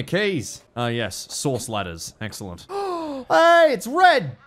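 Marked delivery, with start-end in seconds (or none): 2.7-4.4 high, loud, slow delighted cry; calling out to a beloved dog; excited greeting. Real, not acted. Player spots Red Dog in the game, delighted high call.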